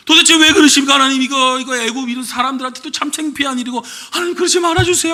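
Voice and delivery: escalated desperate plea, pitched far up; fervent prayer. Retold or imitated. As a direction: cry out to God urgently. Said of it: "하면서 막 기도를 해요".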